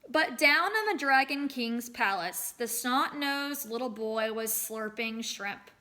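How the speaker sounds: storyteller reading aloud to a class; story narration. A narrator's reading. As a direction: tell the folktale to the children.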